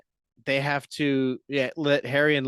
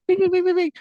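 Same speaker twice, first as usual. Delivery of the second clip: high, louder sung doorbell bings; doorbell imitation; 5 dB louder, much faster than usual. Showing the doorbell Lloyd keeps pressing, sung bings. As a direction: chime it like a ringing doorbell. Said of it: "not that".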